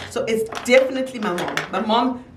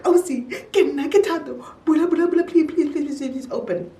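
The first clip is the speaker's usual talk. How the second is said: pleading; 6 semitones higher than usual. Retold or imitated. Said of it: "now I'm in tears".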